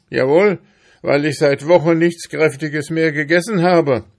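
high, loud, aggrieved hamster; blustering, self-pitying complaint; indignant hamster. Acted. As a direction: protest loudly with wounded indignation. Hamster protests in the story.

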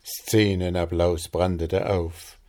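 ordinary delivery

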